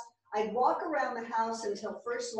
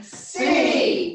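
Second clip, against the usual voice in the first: shouted cheer; 2 semitones higher, 9 dB louder than usual. Acted. Cheer response shouted back.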